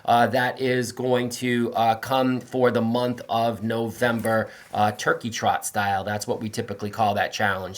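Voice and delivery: ordinary talk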